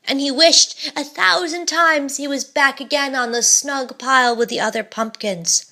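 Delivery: ordinary delivery, nothing marked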